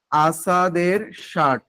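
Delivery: "আসাদের shirt" drawn out at half speed; slow dictation. Shown as a demonstration. Teacher giving students the poem title to write, slow dictation. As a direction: stretch the title syllable by syllable. এটা একটু লিখে নেন.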